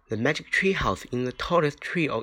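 ordinary delivery, nothing marked

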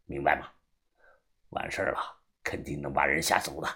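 put-on very low, quiet old man's murmur; scheming old boss. Old man secretly instructing a newcomer to fake madness, low and quiet. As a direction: lay out the scheme low and unhurried.

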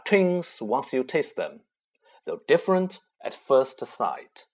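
measured, rhythmic riddle read; poem reading. A narrator's reading. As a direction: recite the verse steadily. Reads riddle verse.

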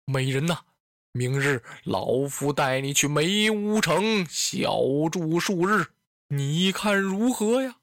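put-on low, slow old master's voice; aging warlord offering a treat; coaxing old lord. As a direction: coax a favourite concubine, unhurried and low. Voices Dong Zhuo coaxing Diaochan.

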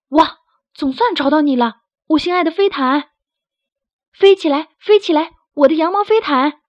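high, loud joyful cry; overjoyed calling. Acted. Berio, finding his lost carpet, loud joyful shout. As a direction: call out to the carpet in delight.

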